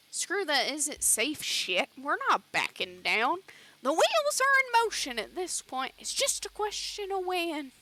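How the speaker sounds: loud, high-swinging hot-headed character voice; agitated miner, pitch leaping up; defiant miner. Acted. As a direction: push back loudly, refusing to back down. Defiant miner answers the worried one.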